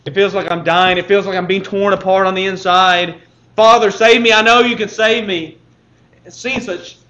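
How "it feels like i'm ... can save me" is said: sorrowful plea rising to a loud cry; Jesus crying out. Acted. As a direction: cry out to the Father in agony. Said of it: "he's weeping and he's crying out".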